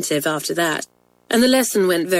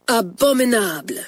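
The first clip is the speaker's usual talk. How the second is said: put-on French-accented mark; 4 semitones higher than usual. The French mistress's mark on the twins' books, French accent. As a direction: give Abominable a French teacher's accent.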